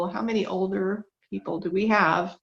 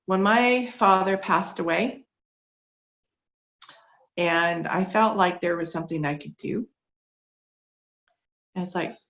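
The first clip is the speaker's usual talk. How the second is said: ordinary talk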